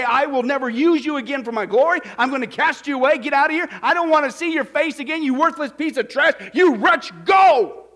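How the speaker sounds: high, loud shouted banishment; furious rejection. Pretend. Preacher acting out God rejecting a sinner, furious shout. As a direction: cast them out, building to a huge shout.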